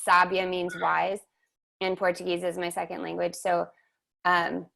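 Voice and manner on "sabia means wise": usual speaking voice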